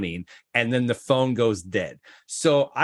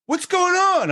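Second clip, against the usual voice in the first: startled, much higher yelp; 4 dB louder, slower than usual. Retold or imitated. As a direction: jump at the door bursting open. Hosts startled by door kicked in.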